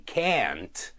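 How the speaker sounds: can't with a flat a as in cat; American can't. Shown as a demonstration. Teacher demonstrating American can't. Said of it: "in American English they do say".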